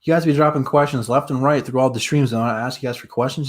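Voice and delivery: ordinary delivery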